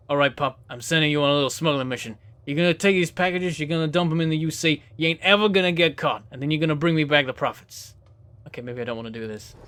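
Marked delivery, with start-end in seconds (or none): 0.1-7.9 lightly done Jersey mobster; easy Jersey swagger handing out orders; Jersey boss giving a smuggling job; Jersey accent. Acted. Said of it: "I had to do the accent".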